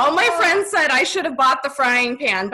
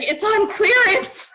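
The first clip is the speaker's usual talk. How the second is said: laughing quote; 10 semitones higher, slower than usual. Retold or imitated. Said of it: "He's like".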